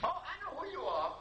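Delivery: high, knowing woman's voice; voicing the wife. Wife at the door greets the healer, in a knowing high voice. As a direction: sound like a woman who already knows.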